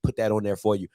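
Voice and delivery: lightly voiced sucking-up player, low and hushed; mock polite opponent. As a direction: play the fake-friendly opponent patting him on the back. Mock sportsmanship from opposing player.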